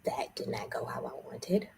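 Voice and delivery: whispered to herself; hushed private confession. Real, not acted. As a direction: breathe it out, barely voiced. Alone after call, confessing.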